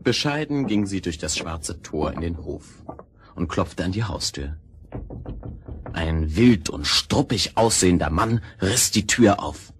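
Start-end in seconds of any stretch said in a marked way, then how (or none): none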